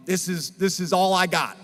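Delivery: ordinary talk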